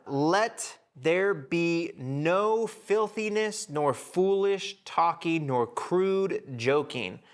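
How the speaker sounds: slow, deliberate Bible reading; scripture reading. Acted. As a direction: read each phrase slowly, with weight. Reads scripture verse aloud.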